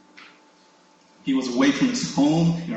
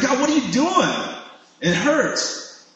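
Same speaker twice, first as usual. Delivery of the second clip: high, exasperated plea; acted-out cry of complaint; pained complaint to God; 9 semitones higher, slower than usual. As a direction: protest to God in a high, hurt voice. Acting out questioning God in pain.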